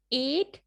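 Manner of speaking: एट slowed, ay vowel stretched; demo word. Shown as a demonstration. Teacher repeating demo words for practice, slowed. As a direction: stretch एट a little. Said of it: "आप लोग ज़ोर डालें".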